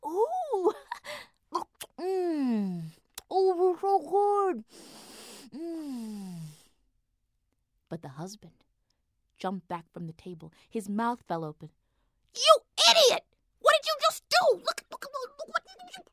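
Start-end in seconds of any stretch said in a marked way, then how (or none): 0.1-6.4 high, slow blissful moaning; delighted mmm. Acted. Wife tastes magic donut. 12.3-16.0 high, loud furious yell; angry shout. Acted. Husband yells at wife.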